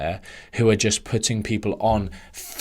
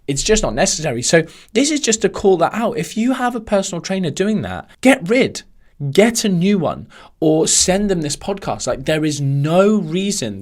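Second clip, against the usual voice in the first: raised, heated and much higher; indignant rant; 9 semitones higher, 5 dB louder than usual. Real, not acted. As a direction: call them out, fired up. Ranting against bad personal trainers.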